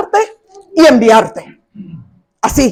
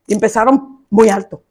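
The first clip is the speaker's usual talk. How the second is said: normal talking voice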